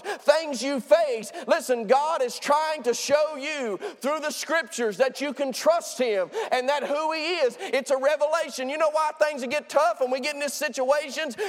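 preacher rising to a climax; fervent preaching. Acted. Preaching at full force.

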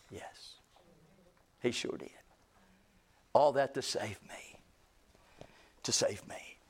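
low, near-whispered wonder; very soft, reverent murmur; hushed awe. Real, not acted. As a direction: let the voice fall almost to nothing. Marvelling quietly after preaching build.

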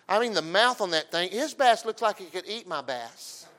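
ordinary talk, nothing marked

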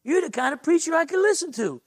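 light up with admiration, high and bright-eyed; lit-up Texan buddy. Retold or imitated. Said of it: "he starts lighting up and saying".

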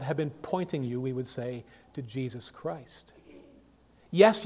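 normal talking voice

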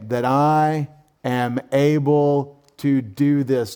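"That I am able to do this" drawn out word by word; deliberate, weighty "That I am able to do this"; slow emphatic quote. Shown as a demonstration. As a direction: stretch "I" and "able", half speed, for emphasis. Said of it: "what does he say?".

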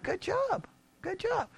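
light, high, hushed mock pat on the back; soft and sing-song, like praising a child; a hint of a condescending good job; mock praise. Jesus mock-praises rich ruler.

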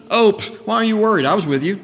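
mock flippant brush-off, fast; flippant dismissal. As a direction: toss it off carelessly. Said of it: "Jesus isn't being flippant".

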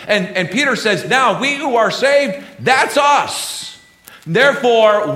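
high, loud fervent preacher pressing each phrase; full-force preaching. Acted. Pastor rallying the congregation behind Israel, in a loud fervent preach. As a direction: preach it out, rising with fervour.

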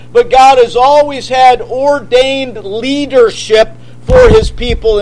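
ordinary talk

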